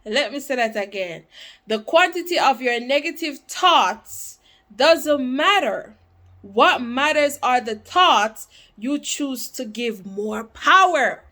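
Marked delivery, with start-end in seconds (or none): 1.7-11.2 emphatic repeat. Real, not acted. Reader repeating a quote to viewers, emphatic. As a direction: drive the quote home with force.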